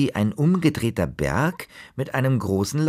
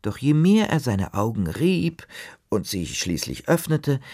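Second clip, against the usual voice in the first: usual speaking voice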